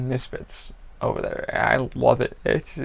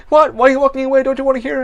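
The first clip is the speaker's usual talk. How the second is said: much higher and louder, hammed-up calling out; theatrical shout at someone leaving; mock call after her; 12 semitones higher, 11 dB louder, faster than usual. Calling after woman walking away.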